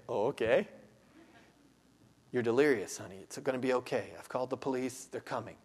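soft, low, reassuring hush; calm her gently; soothing husband. Pretend. Husband to delirious wife on the floor, soft reassurance. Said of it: "And you say".

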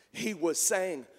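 ordinary talk

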